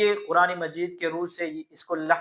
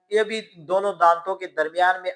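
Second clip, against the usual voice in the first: usual speaking voice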